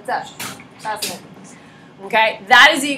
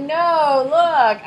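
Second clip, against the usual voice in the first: put-on mild insistent toddler; 4 semitones higher than usual. Toddler demanding attention.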